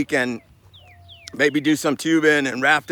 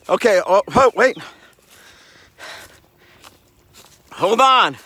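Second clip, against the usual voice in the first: raised, higher voice; calling out; 5 semitones higher, 4 dB louder than usual. Pretend. Hiker asking someone far off to wait, loud calls. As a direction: call out for them to wait.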